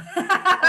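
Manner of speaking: loud, high laugh; burst of laughter. Real, not acted. Laughing at her own joke about being uncool, loud laugh.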